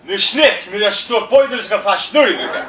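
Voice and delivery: loud mock Russian-sounding gibberish, really German-ish words; fake Russian gibberish. Comic improvising a Russian pizza rant in mock Russian gibberish. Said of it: "I'm just pretending if I could speak Russian".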